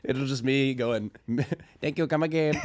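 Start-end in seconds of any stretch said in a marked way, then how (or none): 1.8-2.6 lightly voiced Indian accent; a quick, hammed-up Indian accent. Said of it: "I'll do an Indian accent".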